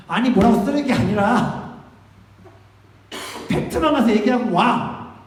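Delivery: high, loud, drawn-out scolding; acted-out exasperated pastor; exasperated correction. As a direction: set them straight, stretching it out loudly. Acts out exasperated correction of youths.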